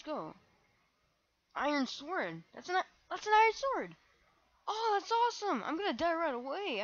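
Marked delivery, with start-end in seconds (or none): 1.5-6.8 high, loud thrilled gush; excited. Real, not acted. Gamer thrilled at finding an iron sword, high and loud. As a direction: burst out over the loot.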